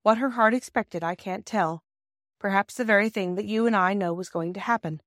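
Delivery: usual speaking voice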